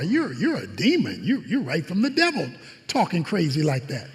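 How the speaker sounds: low, sneering accusation; deep, quiet scornful voice; hostile accusers. Retold or imitated. Leaders accuse Jesus of devil.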